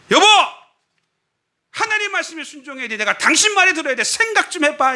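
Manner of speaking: high, exasperated spouse's outburst; acted marital quarrel. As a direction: call out sharply, then argue high-pitched. (배우자에게 높게 쏘아붙이기)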